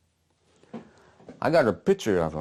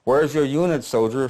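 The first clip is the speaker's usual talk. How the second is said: raised, louder officer's demand; young lieutenant; 5 semitones higher, 4 dB louder than usual. Acted. Young officer questioning a stray soldier, raised commanding voice. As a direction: challenge him like a young first lieutenant. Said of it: "Young guy, first lieutenant".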